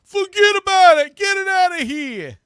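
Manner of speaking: loud, high mock Bronx kid shout; hammed-up New York accent, shouted high. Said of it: "one of those New York accents coming out of a little kid's mouth".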